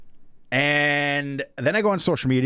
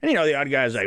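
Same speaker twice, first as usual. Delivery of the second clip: usual speaking voice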